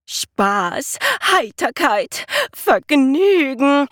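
rising, high, loud and slow shouts of joy; whooping with glee. A narrator's reading. Jonathan delighting in the chaos he caused, gleeful whoops. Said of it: "juchzte er".

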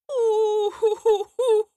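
hohes, gespenstisches Eulenheulen; schreckliches Heulen. A narrator's reading. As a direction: heul wie eine Eule im Nebel. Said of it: "ein schreckliches Heulen". (hohes schauriges Uhu-Heulen)

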